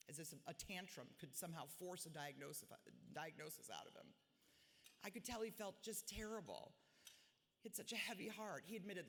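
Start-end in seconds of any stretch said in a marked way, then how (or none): none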